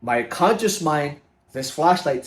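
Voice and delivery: ordinary talk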